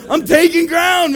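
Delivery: high, loud, amused boast; excited shouted declaration. Retold or imitated. Pastor explaining himself to the guard, exuberant shout. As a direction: proclaim it loudly, close to laughing.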